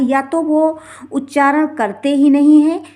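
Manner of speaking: ordinary talk, nothing marked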